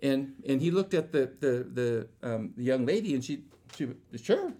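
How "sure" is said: light high shrugging sure; shrugged sure. Retold or imitated. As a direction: toss it off with a shrug, much higher. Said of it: "Sure, shrugged her shoulders".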